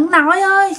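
put-on high, whining spoilt child begging; whiny child. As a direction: nag grandpa like a pouting little kid. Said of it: "làm theo kiểu như con nít mà nhõng nhẽo".

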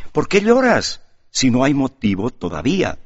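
hint at something hidden in the last words; enigmatic emphasis. A narrator's reading. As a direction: lean on "todavía" with a knowing weight. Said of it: "añadió con aire enigmático, recalcando las últimas palabras". (recalcando "todavía" con aire enigmático)

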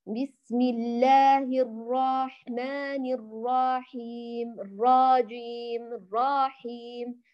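slow recitation with الرحيم stretched to raa-heem; over-stretched vowels. Shown as a demonstration. Teacher imitates reciters' common mistake, stretched ra. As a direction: drag an extra alif after the ra in الرحمن and الرحيم. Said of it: "يسميها علماء التجويد بالتمطيط والإدخال".

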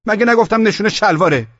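very high, loud rebuke; scolding shout. A narrator's reading. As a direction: bawl out the soldier. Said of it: "سر چراغ علی داد زدم". (داد و توبیخ)

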